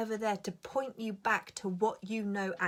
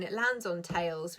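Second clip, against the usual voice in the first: ordinary talk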